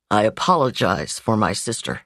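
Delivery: lightly voiced gentleman apologizing; man's voice. Man's voice in a story.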